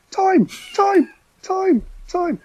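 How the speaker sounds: imitating a footballer's piercing high call; very high-pitched shout. Imitating John Gittins shouting to teammates, very high-pitched. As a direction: shout it way up high, in a comic squeal. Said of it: "very loud and very high-pitched".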